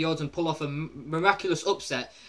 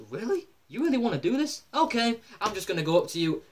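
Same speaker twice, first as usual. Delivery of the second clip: much higher, incredulous mock Lesnar; 6 semitones higher than usual. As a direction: voice Lesnar taunting in disbelief. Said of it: "And Brock Lesnar's like".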